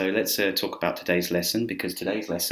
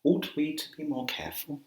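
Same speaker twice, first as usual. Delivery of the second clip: high-pitched posh English, question rising; hammed-up posh English voice; 9 semitones higher, 4 dB quieter than usual. Shown as a demonstration. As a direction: ask it in a mock-royal posh accent. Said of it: "you will sound very posh".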